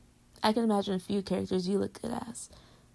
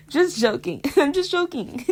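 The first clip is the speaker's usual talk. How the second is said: laughing; 5 semitones higher, 9 dB louder, slower than usual. Real, not acted. Laughing reassurance after tease.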